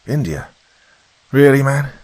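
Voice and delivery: high, loud disbelief on the second half; incredulous. A narrator's reading. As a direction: question it as if it's absurd. Kelly reacts in disbelief.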